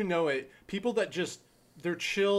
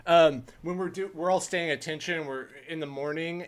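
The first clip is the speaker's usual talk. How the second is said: ordinary delivery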